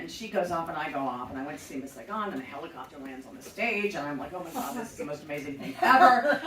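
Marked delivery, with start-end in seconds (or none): 4.3-6.4 louder, higher, laughing awe; amazed. Retold or imitated. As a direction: gush like a thrilled first-timer. Young self amazed by helicopter.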